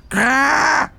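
hoge, langgerekte kraaienkreet; krassende kraai. Acted. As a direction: kras als een kraai. Kraai Kwark krast buiten. (hoog gekras van een kraai)

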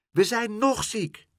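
put-on high, slow kids' chorus; children calling out. The sick kids call back to Pluk, high childish calling. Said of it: "riepen ze".

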